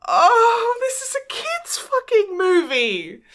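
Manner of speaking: high, loud hammed-up wail; overemotional wail. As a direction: wail in exaggerated heartbroken protest. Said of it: "I'm getting all super fucking emotional".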